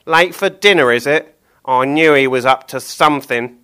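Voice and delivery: you've rumbled him; suspicious Billy. Acted. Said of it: "Billy nodded to himself".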